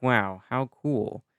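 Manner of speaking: lightly voiced settler, slow drawn-out wow; slow awed settlers. Narrator voicing settlers moving south, slow mild awe. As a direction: stretch the wow in mild wonder. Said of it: "and they're like".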